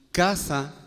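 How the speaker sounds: "casa" said very slowly, with a hard k; casa pronounced slowly. Shown as a demonstration. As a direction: drag out "casa" to show its sound. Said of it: "suena igual que kiosco". ("casa" dicho muy despacio, marcando la k)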